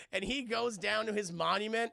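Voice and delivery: ordinary talk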